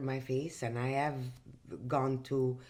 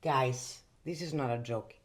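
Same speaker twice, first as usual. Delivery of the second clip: ordinary talk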